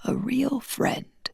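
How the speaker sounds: dying man's last tender words to his friend; near whisper. Acted. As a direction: let the words die away. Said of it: "little more than a whisper".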